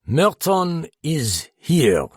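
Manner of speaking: high, loud, very slow proclamation; ringing, drawn-out revelation; dramatic announcement. A narrator's reading. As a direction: announce it loudly, stretching each word.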